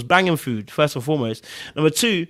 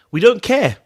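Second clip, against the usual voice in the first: loud, high punch on don't; emphatic outburst; 8 semitones higher, 8 dB louder than usual. Real, not acted. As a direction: blurt it out loudly with a higher pitch. Coach stressing careless attitude.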